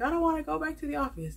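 high, fast, whiny mock voice; teasing imitation of a reluctant office worker; mock whiny complaint. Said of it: "y'all be like".

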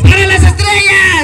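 shouted high, like a stadium announcer; loud high cheer for the stars; loud high cheer call. Real, not acted. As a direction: hype the stars team at full voice. Host calls cheer for stars.

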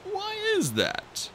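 a hint of a placid dragonette; mild question. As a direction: ask without any offense. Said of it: "Coconut asked mildly".

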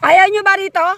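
loud, high teasing call across the path. Real, not acted. Vlogger calls out teasing companions who hang back, loud and high. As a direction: call out to them, half teasing.

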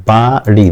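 বাড়ি split as baa-ri; two syllables. Shown as a demonstration. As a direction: say বাড়ি as two separate beats. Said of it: "দুইবার উচ্চারণ হচ্ছে". (বাড়ি ভেঙে "বা-ড়ি" দুইবারে)

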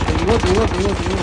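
loud, rapid-fire insistence; urgent repeated yelling; insistent shout. Real, not acted. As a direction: hammer it out loudly. Insisting loudly mid-game.

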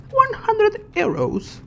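very high, drawn-out squeal; slow, comic high-pitched exclamation; mock outrage. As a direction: squeak the price in exaggerated falsetto disbelief.

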